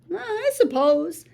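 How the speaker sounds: ordinary delivery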